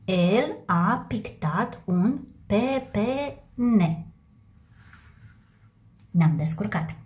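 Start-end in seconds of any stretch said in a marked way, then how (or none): 0.0-4.1 very slow, each word drawn out; slow read-back. Shown as a demonstration. Teacher reading the finished sentence to pupils, very slow. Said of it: "Așa trebuie să arate".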